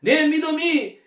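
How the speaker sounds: loud, slow, high declaration; proclaiming praise. Acted. Jesus praising the woman's faith, loud and slow. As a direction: ring it out like a blessing.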